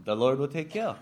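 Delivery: everyday Hawaiian pidgin, quick and natural. Retold or imitated. Telling Uncle Ben the lesson title, in Hawaiian pidgin. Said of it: "it's called pidgin language".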